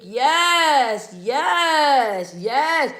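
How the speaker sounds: high, stretched-out celebratory yeses; drawn-out cheering. Real, not acted. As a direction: cheer each one long and high. Cheering along with chat for helper.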